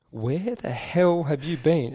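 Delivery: exasperated demand. Retold or imitated. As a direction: drag out the telling-off.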